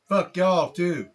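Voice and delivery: high, loud gruff retort; parting curse. Acted. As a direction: fire the insult back over your shoulder. Slump's parting shot.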